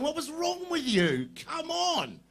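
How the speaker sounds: high mocking disbelief from future kids; mock kids laughing at us. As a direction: tease like a kid who can't believe it. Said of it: "laughing, going".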